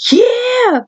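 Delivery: high, stretched, eager yeah; excited yeah. Retold or imitated. Friend confirming the bloody nose, a high eager yeah. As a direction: drawl it out, thrilled. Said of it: "he goes".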